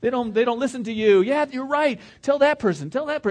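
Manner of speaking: high, excited goading voice; hammed-up instigator cheering on the grumbling; Satan egging on. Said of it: "He'll just".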